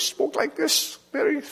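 very high, thin squeaky voice. Retold or imitated. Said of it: "a big squeaky voice".

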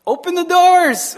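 high, loud, comic command; eager mock shout. Israelite men welcoming the women in, eager mock shout. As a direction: call out as if overjoyed.

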